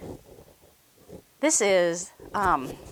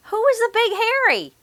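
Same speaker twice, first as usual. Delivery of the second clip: high quick question; 12 semitones higher, faster than usual. Acted. Little Bob asks quickly.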